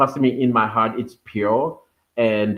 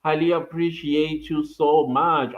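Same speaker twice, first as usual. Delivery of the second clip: usual speaking voice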